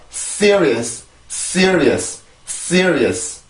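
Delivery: "Serious" as crisp English, strong s, stressed first syllable; English pronunciation demo. Shown as a demonstration. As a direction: say "Serious" English-style in three quick syllables, falling. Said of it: "한 번 더". (영어식 "Serious" 발음 시범)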